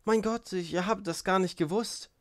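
high, stunned exclamation; shocked dismay. A narrator's reading. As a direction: gasp it out as news sinks in. Shocked by news of a death.

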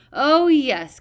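high, loud gloating; malicious. A narrator's reading. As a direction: savour the cruelty. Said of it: "said maliciously".

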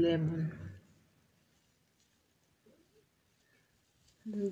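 partly covered mouth briefly; gloved finger on lips. Real, not acted. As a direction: hand brushing mouth while talking. Seen 0: Glove touches lips only at first; mouth free from 0.6s.

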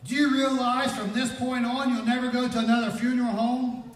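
high, loud, sustained proclamation; fervent preaching. Real, not acted. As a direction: proclaim it at full force.

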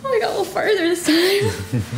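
high, teary voice tipping into laughter; rally through tears, near laughing at yourself; tearful. Real, not acted. Mother after another broken attempt at the story, tearful.

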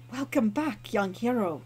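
lightly voiced courteous innkeeper; bow and greet him graciously; polite cat innkeeper.

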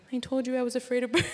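laughing through the line, fast; fast laughing retort cut short. Real, not acted. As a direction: giggle as the words break off. Breaks into laughter retelling.

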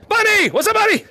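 high, delighted call; excited greeting. Real, not acted. Streamer greeting a player by name, excited high call. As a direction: call out high to someone just spotted.

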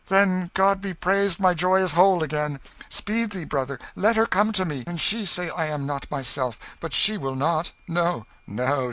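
high, loud, eager joy; Miles, overjoyed, begs his brother to fetch Edith; joyful relief. A narrator's reading. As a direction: urge them on in glad haste.

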